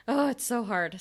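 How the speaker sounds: ordinary talk, nothing marked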